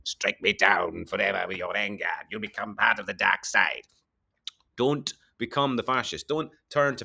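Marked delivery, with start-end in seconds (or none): 0.0-3.8 Palpatine. Acted. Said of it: "like Palpatine wants us to".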